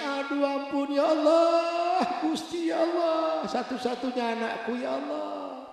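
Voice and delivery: acted weeping mother, high and soft; tearful wailing. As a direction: draw out a slow, hushed wail to God. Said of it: "ibu ini nangis".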